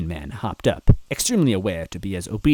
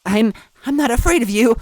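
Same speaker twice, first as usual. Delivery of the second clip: high loud stammered defiance; huffing, stammering; 10 dB louder than usual. A narrator's reading. Toadette facing the villain, stammering brave shout. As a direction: fake bravery with a stumble. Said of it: "Toadette huffed".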